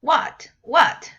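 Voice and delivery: what what falling and loud; teacher comparing fourth tone to a sharp what; indignant what. Shown as a demonstration. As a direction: snap what what downward. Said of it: "It's like".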